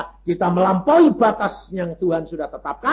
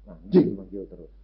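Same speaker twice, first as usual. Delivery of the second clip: imitating a maid cursing, lower and softer; a coarse grumble under the breath; rough muttered curse; 11 semitones lower, 4 dB quieter than usual. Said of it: "suaranya siapa kok kasar kayak gitu". (menirukan pembantu mengumpat kasar, lebih rendah dan pelan)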